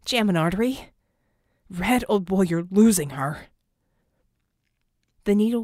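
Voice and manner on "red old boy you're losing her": urgent self-talk. Acted. Red urging himself on.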